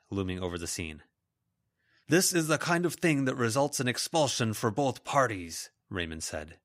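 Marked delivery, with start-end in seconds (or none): 2.1-5.7 higher, lightly voiced stern authority; reprimanding teacher. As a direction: admonish them as a teacher. Raymond reprimands the fighting students.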